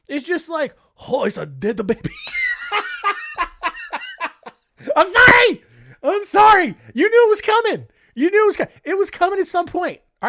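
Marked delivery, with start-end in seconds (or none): 1.1-2.1 light, offhand Japanese accent, barely put on; mock Japanese accent. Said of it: "So when I'm impersonating a Japanese person". 2.2-4.5 burst of laughter. Real, not acted. Bursts out laughing at own joke. 4.9-9.4 high, loud, still laughing through the apology. Real, not acted.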